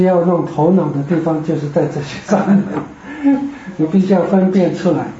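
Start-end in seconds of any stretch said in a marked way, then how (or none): 3.1-3.7 brief high chuckle; a small real laugh; short laugh. Real, not acted. Teacher admitting Greek takes brainwork, short high chuckle.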